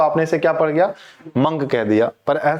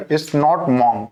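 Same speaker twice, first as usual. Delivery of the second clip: normal talking voice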